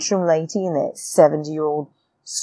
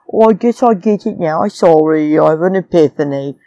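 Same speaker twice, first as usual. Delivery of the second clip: put-on baby accent; 5 dB louder than usual. Vlogger mimicking a player's epiphany, loud baby accent. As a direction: ridicule it in a silly babyish voice. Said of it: "stupid little baby accent".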